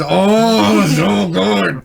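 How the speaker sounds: high, loud fan swoon; gushing excitement. Real, not acted. Fan gushing over B-Wings and Y-Wings, thrilled. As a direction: draw it out in delight.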